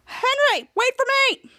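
high-pitched pleading call. Retold or imitated. Ray calls after Henry, high urgent call. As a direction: call for them to wait.

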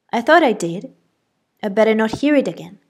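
loud, fast stern warning. A narrator's reading. Aunt warning the girl off swearing, loud fast stern. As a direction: warn her sharply, quick and loud.

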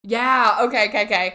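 eager, loud rapid okays; can't wait to hear it; excited. Real, not acted. Eager for a spooky tale.

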